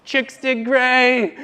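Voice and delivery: cocky pose line. Retold or imitated. Posing for cousins at the reunion, slow hammed-up swagger. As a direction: strut it out like a swaggering stud.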